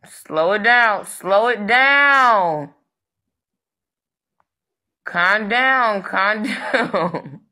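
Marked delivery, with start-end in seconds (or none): 1.1-2.7 drawn out, slow and a little louder; stretched-out slow. Shown as a demonstration. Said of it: "if y'all going so fast". 6.0-7.4 laughing. Real, not acted. Laughing through calm down.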